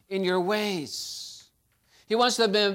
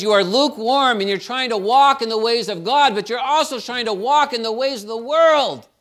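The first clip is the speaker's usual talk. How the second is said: loud preached rebuke, raised high; forceful rebuke; 5 semitones higher, 10 dB louder than usual. Retold or imitated. Preacher voicing God's rebuke to church, loud. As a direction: thunder the warning.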